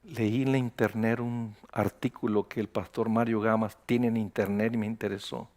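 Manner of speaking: barely above a murmur, embarrassed; very quiet voice. Retold or imitated. Pastor imitating a timid phone caller, very quiet. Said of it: "y una voz muy bajita".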